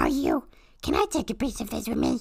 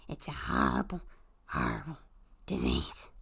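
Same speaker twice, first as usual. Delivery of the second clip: hushed ominous warning; 8 dB quieter than usual. Acted. Bee warns about the horrible disease in a dark hush. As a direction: drop to a soft, ominous near-whisper.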